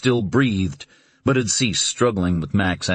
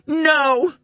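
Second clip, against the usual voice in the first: desperate cry. Acted. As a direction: tear it from the throat. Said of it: "The cry burst from her throat".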